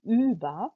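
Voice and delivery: über with a long, slow rounded ü; stretched umlaut ü. Shown as a demonstration. Teacher models über slowly for students. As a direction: draw out the ü in über. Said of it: "थोड़ा सा लंबा खींच के".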